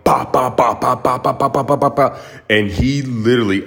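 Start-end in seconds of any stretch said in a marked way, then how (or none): 0.1-2.2 quick repeated ba ba ba punch sounds; mock punch noises. Boxer unloading punches on his stomach, mimicked punch noises. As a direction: rattle off the blows like a drumroll. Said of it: "starts literally just going to town".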